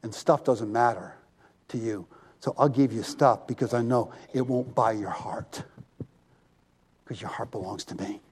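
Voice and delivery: low, soft and intimate; hushed reassuring voice of God; God speaking tenderly. Retold or imitated. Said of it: "He goes".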